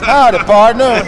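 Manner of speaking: loud, slow Western cowboy greeting; western howdy. Acted. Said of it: "when they got out west".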